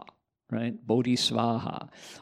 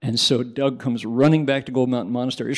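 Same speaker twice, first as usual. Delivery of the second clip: ordinary talk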